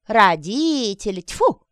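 loud mocking disdain; scornful. A narrator's reading. Heckler scorns the appeal to parents.

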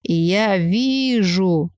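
very slow, eerie seer voice; drawn-out mystic. Acted. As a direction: stretch it, spooky and distant. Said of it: "протянула девица".

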